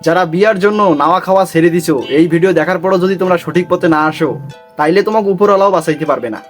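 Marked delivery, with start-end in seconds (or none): none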